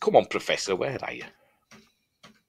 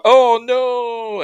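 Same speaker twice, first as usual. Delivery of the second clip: very high, loud hammed-up gasp; the whole crowd shocked at once; crowd's horror; 13 semitones higher, 6 dB louder than usual. Crowd watching the dwarf in its gob, shrill mock horror.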